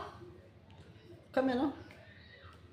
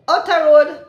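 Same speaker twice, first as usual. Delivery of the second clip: higher and much louder sign-off catchphrase; 8 semitones higher, 15 dB louder than usual. Acted. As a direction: call it out as a cheerful goodbye. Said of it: "sign-off catchphrase".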